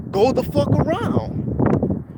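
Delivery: high drawn-out yell; mock angry shout from a car; retold yell. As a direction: bark it out slow and high. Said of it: "he yells out".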